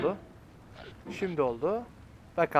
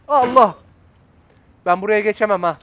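alarmed outcry; 2 semitones higher, 7 dB louder than usual. Real, not acted. Player realising they are in trouble, alarmed shout. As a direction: cry out in sudden alarm.

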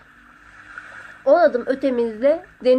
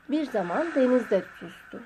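usual speaking voice

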